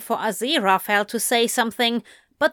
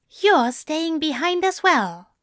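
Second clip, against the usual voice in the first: informing; 7 semitones higher than usual. A narrator's reading. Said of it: "Zira informed Anthony".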